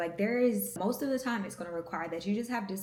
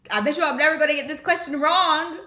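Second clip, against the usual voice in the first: high, loud, hammed-up declaration; playful loud boast; 8 semitones higher, 10 dB louder than usual. Student boasting about the exam question, playfully loud. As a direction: belt it out as a triumphant joke. Mock boast after silly accent reading.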